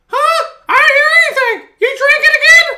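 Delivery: high, loud mock-wife voice; imitating his wife.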